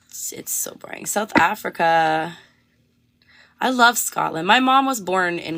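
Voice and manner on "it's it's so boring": breathy whisper, much softer. Shown as a demonstration. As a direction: drop to a whisper on it. Repeats boring, then whispers it.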